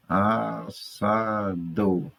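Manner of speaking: very slow As-sa-do, each syllable stretched. Shown as a demonstration. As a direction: break "Assado" into separate, drawn-out syllables. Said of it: "aí está a separação silábica". ("Assado" bem devagar, sílaba por sílaba)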